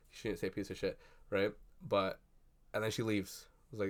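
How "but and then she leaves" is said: ordinary talk, nothing marked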